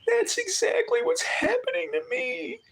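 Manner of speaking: imitating the girl crying that his story is hers; imitating her in tears. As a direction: mimic a woman breaking down, up high. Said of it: "she goes".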